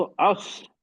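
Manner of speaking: अस with the final स hissed out until it fades; breath stops by itself. Shown as a demonstration. Teacher showing a student how breath ends, free-flowing अस. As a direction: let the breath on अस run out on its own. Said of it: "सांस अपने आप रुकेगी".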